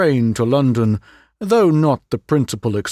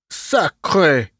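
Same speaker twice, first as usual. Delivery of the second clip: sharp, indignant French oath; Poirot cursing at the empty cavity; angry cry; 4 semitones higher than usual. A narrator's reading. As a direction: spit out the curse in frustration. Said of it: "cried Poirot angrily".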